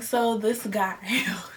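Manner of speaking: hushed whisper. Real, not acted. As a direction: whisper it like gossip. Hushed start of gossip.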